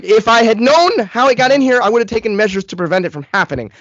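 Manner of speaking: high, loud, mounting exasperation; acted-out frantic retort; exasperated rant. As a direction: snap back with frustrated sarcasm.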